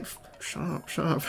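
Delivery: whispered, stifled shushing; retold urgent hushing. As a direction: hush the child under your breath. Hushing daughter within driver's earshot.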